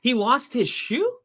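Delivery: high, disbelieving exclamations; can't believe what you see; surprised. Real, not acted. Player loses shoe on play.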